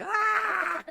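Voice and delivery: high, loud laughing scream; startled shriek. Acted. Girl feeling rats in her bed, shriek. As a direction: shriek as if something moved.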